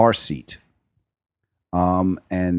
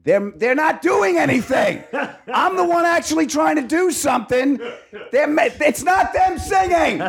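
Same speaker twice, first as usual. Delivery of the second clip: indignant shout. Retold or imitated. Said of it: "And I'm like".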